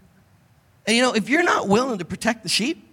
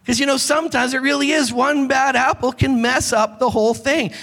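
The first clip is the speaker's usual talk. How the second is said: ordinary talk, nothing marked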